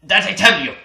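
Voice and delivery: loud, high menacing German; evil German voice. Retold or imitated. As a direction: command it like a villain. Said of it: "more evil".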